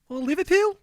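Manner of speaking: Liverpool? said high and loud in a mock Scouse accent; a pretty good Liverpool Scouse put-on. Greeting the Liverpool crowd in a high, loud mock Scouse accent. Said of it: "That's pretty good".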